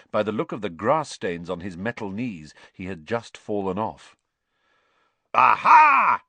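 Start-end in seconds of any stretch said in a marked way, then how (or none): 5.3-6.2 loud, high triumphant shout; yell. A narrator's reading. Knight spotting the trespassers, yelling. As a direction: call out to the intruders. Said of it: "he yelled".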